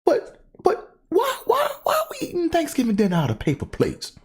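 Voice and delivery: sweet words over anger, pitched high; imitating mom. Mom confronting the aunt about paper plates, high. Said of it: "she was like".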